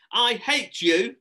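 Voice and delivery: high, loud, slow outburst; drawn-out hateful shout; barked angrily. Acted. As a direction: hurl it as a furious child. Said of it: "Harrison barked".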